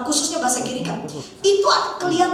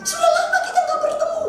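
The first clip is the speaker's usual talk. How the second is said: high-pitched gushing greeting; greeting an acquaintance; 13 semitones higher than usual. Retold or imitated. Stranger greets someone from behind, high gushing voice. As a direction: pitch it way up, like a long-lost friend. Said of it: "Jadi dia gini".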